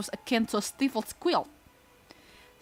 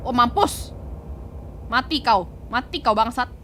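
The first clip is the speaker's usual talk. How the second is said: loud, gleeful cheering; excited yelling; 4 semitones higher, 5 dB louder than usual. Real, not acted. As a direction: cheer the punch on. Cheering the punch.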